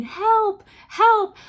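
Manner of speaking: high, loud cry of Help, help; screaming for help. Retold or imitated. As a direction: yell it as if trapped and in pain. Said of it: "I started screaming and yelling".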